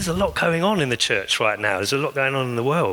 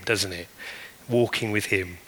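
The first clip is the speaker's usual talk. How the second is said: ordinary delivery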